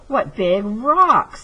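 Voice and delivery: slow, drawn-out big dinosaur; lightly voiced friendly dinosaur; storybook dinosaur voice. A narrator's reading. Dinosaur voice in read-aloud story.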